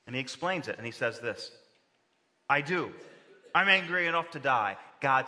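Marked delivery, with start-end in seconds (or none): 2.5-4.8 angry Jonah. Acted. Preacher voices angry Jonah quote.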